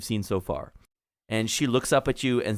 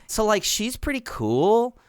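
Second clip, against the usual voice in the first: put-on valley girl gnome; 8 semitones higher than usual. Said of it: "Clotilda says".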